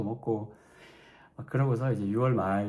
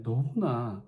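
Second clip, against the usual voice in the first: ordinary delivery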